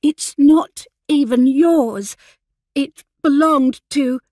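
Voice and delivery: high, loud, slow indignant retort; scowling retort. Acted. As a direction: snap back with a scowl, stretching the words. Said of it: "Viola scowled".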